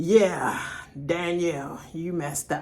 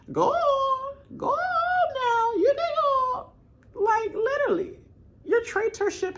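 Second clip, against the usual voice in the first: high squealing laugh-talk, much higher and louder; 6 dB louder, slower than usual. Real, not acted. Vlogger cheering on a contestant, squealing with laughter. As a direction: crack up while egging her on.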